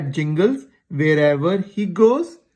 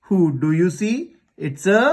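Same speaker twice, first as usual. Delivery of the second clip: ordinary delivery, nothing marked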